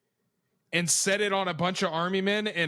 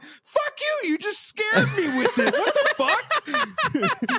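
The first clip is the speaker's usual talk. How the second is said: much higher, laughing mock yell, slow at the end; scared kid yelling; 3 dB louder, slower than usual. Scared kid yells at grandparents over the dinosaur, laughing high yell. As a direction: shriek back at the pranksters half-laughing. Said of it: "We're crying and screaming".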